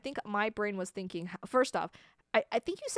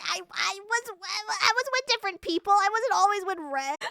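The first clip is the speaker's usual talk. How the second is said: high, stammering protest; high-pitched flustered denial; flustered self-defense; 10 semitones higher, 5 dB louder than usual. Real, not acted. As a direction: plead your alibi, pitched up and rising in volume. Defending self against accusation.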